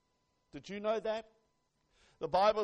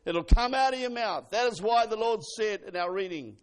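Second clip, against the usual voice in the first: ordinary talk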